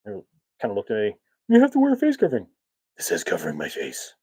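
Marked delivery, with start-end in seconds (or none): 1.5-2.5 very high, loud, fast staff voice; mock high-pitched nagging, rattled off; store staff insisting. Store worker insisting on a face covering, high hurried mock nag. 3.0-4.1 low superhero voice. Retold or imitated. Masked shopper answering back to staff, low Batman voice.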